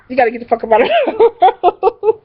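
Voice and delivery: high, giggling eviction; laughing while quoting. Retold or imitated. Cousin ordering her out, laughing. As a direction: quote her through laughter. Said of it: "So she was like".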